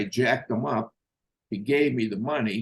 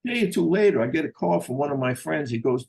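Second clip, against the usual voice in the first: ordinary talk, nothing marked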